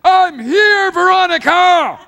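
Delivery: high, drawn-out full-force scream; belted, very high yell; screaming at top of voice. Acted. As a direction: scream it to someone who can't hear you. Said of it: "He's screaming top of his voice".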